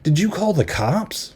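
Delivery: hammed-up alarm at the video; lightly played startled heroine; mock freak-out. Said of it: "Amy freaks out".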